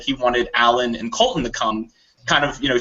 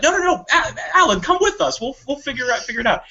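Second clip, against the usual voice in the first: much higher, pleading insistence; hammed-up high pleading; mock insistence; 10 semitones higher than usual. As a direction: urge him to come. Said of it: "Matt's like".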